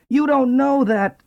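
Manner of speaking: high, urgent rooster voice. A narrator's reading. Rooster warns the donkey, high and urgent. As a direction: plead the warning up high.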